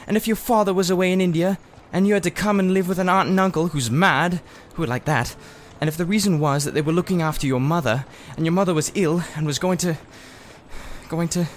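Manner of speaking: high, fast, upset outpouring; wound up, near tears. A narrator's reading. Diggory, upset, telling Polly about his sick mother. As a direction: rush it out, voice catching near the end. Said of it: "too wound up to take any notice".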